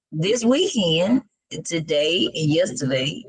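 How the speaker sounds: ordinary talk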